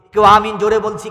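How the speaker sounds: জোরে বলা. Shown as a demonstration. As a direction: lift the volume to show saying it loudly. Contrasts loud and soft Amin. (জোর গলায়)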